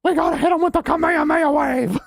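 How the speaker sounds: very high, shouted through laughter; screaming anime villain, laughing; Vegeta voice. Pretend. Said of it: "in Vegeta voice".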